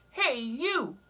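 high, loud, grumpy bug; grouchy ladybug voice. Acted. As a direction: bark the challenge up high. Ladybug challenges whale.

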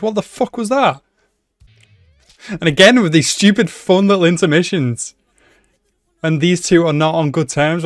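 loud, high, outraged complaint at the show; indignant rant. Real, not acted. Viewer ranting loudly at the show for cutting away. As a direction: vent your outrage at the episode cut.

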